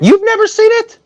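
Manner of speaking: voice jumps high. Real, not acted. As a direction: squeak it in disbelief. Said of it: "my voice go ten registers up".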